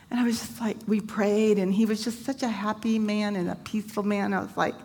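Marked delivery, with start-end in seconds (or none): none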